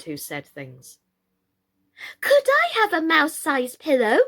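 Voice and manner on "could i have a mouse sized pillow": put-on much higher, louder little boy; excited. A narrator's reading. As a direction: beg eagerly like a small child. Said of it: "asked Apple, excited".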